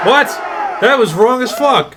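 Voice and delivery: loud, high, slow outrage; outraged shout. Real, not acted. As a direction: drag out the disbelief. Outraged at the platoon ranking.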